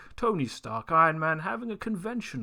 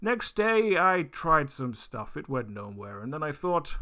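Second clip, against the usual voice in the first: ordinary talk, nothing marked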